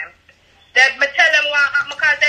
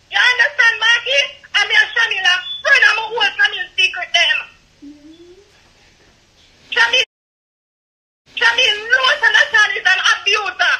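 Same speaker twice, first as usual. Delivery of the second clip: breaking down in tears while yelling accusations; real tearful outburst; 5 semitones higher than usual. Real, not acted. Tearful caller on a livestream accuses Shami, crying and shouting. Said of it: "I know you're emotional".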